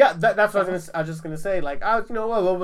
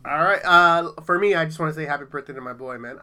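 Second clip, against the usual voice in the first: normal talking voice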